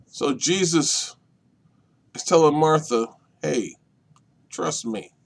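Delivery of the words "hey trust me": softer, near-whisper reassurance; intimate hush. Acted. Jesus softly reassuring Martha, hushed. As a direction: lean in and breathe it.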